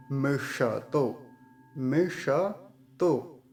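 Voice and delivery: मिषतो very slow, ष held whole; full retroflex sibilant. Shown as a demonstration. Teacher drills मिषतो slowly for students. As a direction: lean on the ष in मिषतो. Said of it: "श को पूरा ही रखेंगे".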